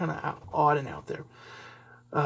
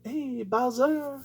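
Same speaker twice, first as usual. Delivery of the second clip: a hint of Mario's voice, slow; lightly voiced game line; Mario greeting; 6 semitones higher, much slower than usual. Shown as a demonstration. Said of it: "he can do those things".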